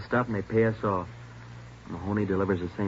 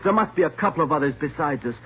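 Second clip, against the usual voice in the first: much higher and louder, insisting; loud, high-pitched denial; desperate insistence; 8 semitones higher, 5 dB louder than usual. Acted. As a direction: protest with rising panic. Suspect insisting under interrogation.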